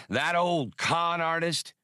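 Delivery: high, slow delighted whoop; a narrator's high whoop of delight. A narrator's reading. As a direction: whoop it out with glee. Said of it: "Badur whooped".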